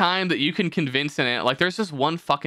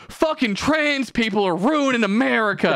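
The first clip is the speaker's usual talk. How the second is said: high, put-on complaining voice; mock bigoted rant; 9 semitones higher than usual. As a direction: caricature an outraged poster, pitched well up. Mocking a bigot's ranting tweet.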